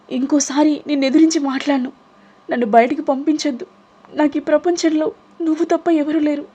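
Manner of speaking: high weeping entreaty; crying, begging. Acted. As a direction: plead desperately with folded hands. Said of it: "ఏడుస్తూ చేతులు జోడించి".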